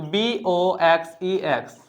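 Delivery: letter by letter, unhurried; slow spelling. Shown as a demonstration. Teacher spelling the plural for students, slowly. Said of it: "ई एस add कर दीजिए".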